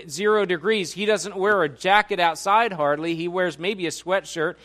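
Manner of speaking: ordinary delivery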